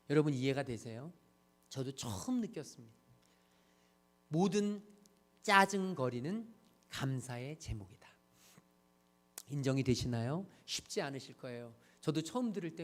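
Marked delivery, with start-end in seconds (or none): 4.3-8.1 slow, spaced repetition. Shown as a demonstration. Preacher repeating the title for listeners to absorb, slow and spaced. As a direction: let each phrase sink in.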